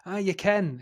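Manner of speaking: lightly put-on Ayrshire voice; Ayrshire accent. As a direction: imitate a casual Ayrshire local. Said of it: "'cause their Ayrshire says".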